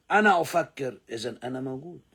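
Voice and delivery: ordinary delivery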